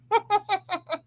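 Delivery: a quick high giggle. Real, not acted. Laughs after joke.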